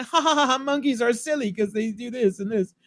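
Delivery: high, silly fake laughing chatter; giggly imitation of carefree frolicking; mock giddy laughter. Couple frolic at the monkeys, put-on giddiness.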